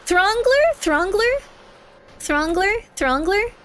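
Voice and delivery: "Throngler" asked high with rising tune, pitch settling each time; "Throngler" rising, said with th-r cluster; trying out the name. Shown as a demonstration. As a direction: test "Throngler" like a question, starting very high. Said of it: "I don't pronounce words".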